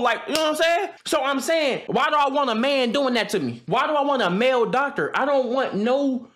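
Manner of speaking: usual speaking voice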